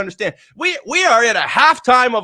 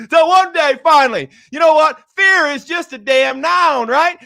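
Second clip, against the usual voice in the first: higher and louder, rising with energy; fired-up declaration; 6 semitones higher, 4 dB louder than usual. Real, not acted. Coach rallying his audience: fired up, higher and louder. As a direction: hype them up like a halftime speech. Building to his turning point.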